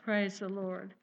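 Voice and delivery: ordinary talk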